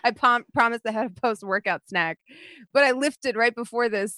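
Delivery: ordinary talk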